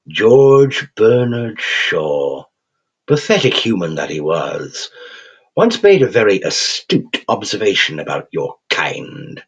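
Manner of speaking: a cheerful English creature savouring every word; cheerful English accent. Acted. Raven creature chiding the thug in a cheerful English accent. Quoth's held voice.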